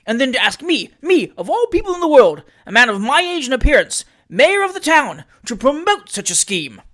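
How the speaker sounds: high, loud, indignant spluttering; affronted and loud, peaking high; pettish outburst. A narrator's reading. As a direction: protest pettishly, voice leaping up on each phrase. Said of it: "pettishly ejaculated Joseph Overton".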